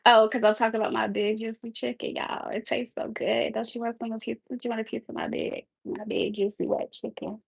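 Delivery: mock sultry hush; fake sexy voice. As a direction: tease the chat like a seductive cook. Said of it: "my fake cooking sexy voice".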